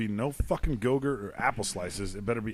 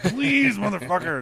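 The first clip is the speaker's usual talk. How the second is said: pleading yell; 8 semitones higher, 5 dB louder, slower than usual. Retold or imitated. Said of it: "I'm like".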